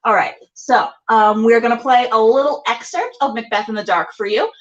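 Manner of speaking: ordinary talk, nothing marked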